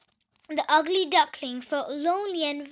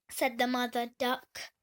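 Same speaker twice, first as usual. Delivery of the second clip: ordinary delivery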